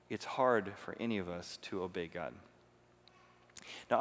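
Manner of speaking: normal talking voice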